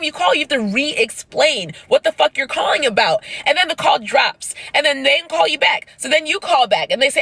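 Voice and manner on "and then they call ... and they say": ordinary delivery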